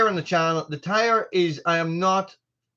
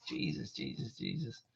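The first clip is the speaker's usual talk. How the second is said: muttering; 3 semitones lower, 16 dB quieter than usual. Real, not acted. Muttering to himself.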